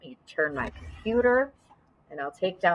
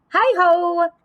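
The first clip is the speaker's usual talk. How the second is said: high, loud cheery hello; cheery call; 11 semitones higher, 7 dB louder than usual. Acted. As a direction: call out brightly from the door. Hare calls from door.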